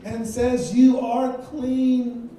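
loud, slow and fervent; preaching shout. Real, not acted. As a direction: build to a fervent climax. Preacher rises to the climax.

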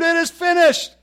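loud, high triumphant scream. Acted. Preacher voicing Jesus' scream of faith, screaming. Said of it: "Ridiculous faith screamed".

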